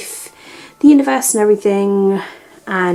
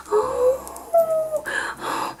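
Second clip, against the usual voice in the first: high squeal of delight; 6 dB quieter than usual. Real, not acted. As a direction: squeal at the gift.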